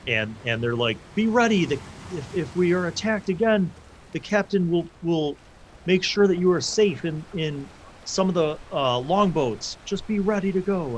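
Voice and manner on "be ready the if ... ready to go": higher-pitched crewman's reassurance; urgent warning. Acted. Crewmen warning the family to be ready, urgent. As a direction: urge them to be ready, pitched up.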